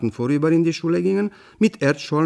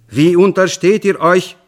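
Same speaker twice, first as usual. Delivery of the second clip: loud, higher outraged call; indignant shout; 6 semitones higher, 8 dB louder than usual. Acted. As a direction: scold the farmer for using the stolen bee. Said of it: "rief ich aufgebracht". (empörter, lauter Ruf)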